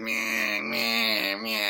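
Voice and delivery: bleated pretend sobs; droning wordless mock wail; mock crying. Traumatized boy unable to speak, mock crying. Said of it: "he's just crying like".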